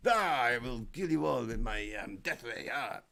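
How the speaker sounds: slow, drawn-out fake Russian villain; hammed-up Russian accent, slow and menacing; cartoon Russian villain accent. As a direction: do a bad Russian baddie, veering into Transylvania. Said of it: "a cartoon Russian's name".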